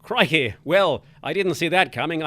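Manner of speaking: ordinary talk, nothing marked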